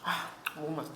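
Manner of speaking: talking around a hot bite; words through a mouthful of hot meat; mouth full of hot food. Real, not acted. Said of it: "아, 뜨거워".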